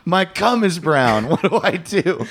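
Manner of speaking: high anxious patient voice; worried patient. Pretend. Patient pleading with doctor.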